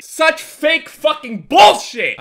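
slow, loud, high emphasis; angry rant. Real, not acted. Gamer denouncing a winner's good fight, angry rant. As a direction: hammer out each word in disgust.

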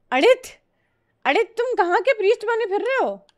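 high, fast put-on teasing voice; teasing mock voice. Teasing viewer Adit about his priest name, in a high put-on voice. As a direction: squeak the question out like a cartoon tease.

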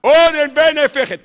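proclaimed loud and high; mock prophet's cry. Rabbi to his listeners, mimicking the prophet's cry. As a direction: cry out the doom warning. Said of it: "צעק".

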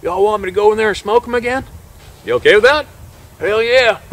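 high, loud, eager quote; keyed-up acted exchange. Hunter and guide agreeing to go in after the bear, high and keyed-up. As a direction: pitch it well up, urgent and game.

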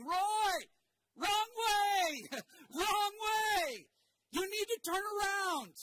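frantic high shout down the sidelines; yelling. Retold or imitated. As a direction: scream at the runner to turn back. Sidelines yelling at wrong-way runner.

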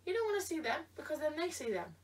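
ordinary talk

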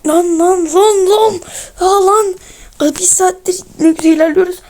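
high, loud exclamations of disbelief; real gamer rage, easing slightly into complaint; frustrated outburst. Real, not acted. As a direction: blow up at the game, voice jumping up. Outburst at losing progress.

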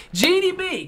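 high, loud name call; excited call. Real, not acted. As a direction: sing out the name. Shouting out a viewer.

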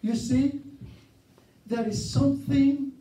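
a preacher stretching out each phrase; preaching. Acted. Opens with emphatic preaching.

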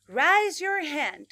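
loud, slow, drawn-out commands; over-articulate for kids to repeat; repeat-after-me classroom commands; exaggerated teacher model. Shown as a demonstration. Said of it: "exagerado para pronunciar".

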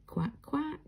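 whispered duck quacks; breathy mock quacking. Duck sound after bingo call.

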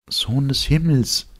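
put-on high, tender young woman's voice; tender and soft. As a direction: say it with tender wonder. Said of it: "sagte sie zärtlich und leise". (zärtlich und leise, hohe Frauenstimme)

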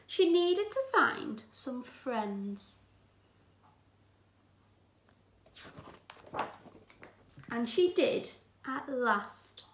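put-on gentle, clear read-aloud for children; teacher reading a picture book aloud; storybook reading. A narrator's reading. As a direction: read it like a bedtime story to the class.

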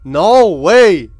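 ordinary delivery

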